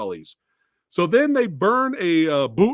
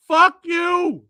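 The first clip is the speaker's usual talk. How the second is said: louder and higher, a final curse at the movie; angry outburst; 8 semitones higher, 6 dB louder than usual. Real, not acted. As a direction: spit it out at the film. Reviewer cursing the movie again.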